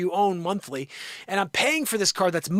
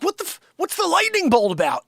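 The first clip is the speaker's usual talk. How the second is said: pitched up, startled and confused; baffled outburst; 11 semitones higher, 3 dB louder than usual. Retold or imitated. Realtor startled by a dashboard warning, baffled outburst. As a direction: blurt it like the dashboard just betrayed you.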